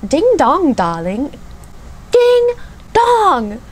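retry the British catchphrase, swooping high then falling; second go at a mock British judge accent, pitch leaping up; [name]'s British accent, second try. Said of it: "Let me try again".

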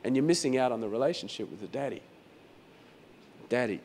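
usual speaking voice

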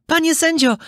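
high-pitched, polite little puppet voice. A narrator's reading. Puppet addressing the gorilla judge, politely. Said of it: "powiedział uprzejmie".

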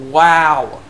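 loud, disgusted-at-it "wow", retold; retold mad reaction. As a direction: act out a mildly mad reaction. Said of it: "I was mad when I saw that".

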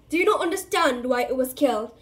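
loud, defiant centaur voice; bellowing. Acted. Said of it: "Firenze bellowed at Bane".

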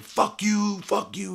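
high, loud mocking yell of an angry inmate. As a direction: bark it back like a door warrior. Inmate banging on door yelling.

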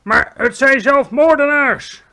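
slow, very loud high shout. A narrator's reading. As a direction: protest at full volume. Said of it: "riep het hoofd van het leger". (luid protesterend)

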